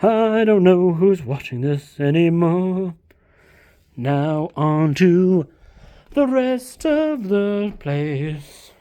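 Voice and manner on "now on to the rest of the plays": very slow, stretched words; slow drawn-out announcement. Pretend. Announcing the next shelf very slowly. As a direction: drag it out grandly.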